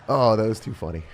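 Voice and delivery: ordinary talk, nothing marked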